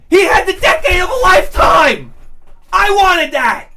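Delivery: high, loud comic outburst; envious shouting. Acted. Arguing the smoker had fun he missed, outraged shout.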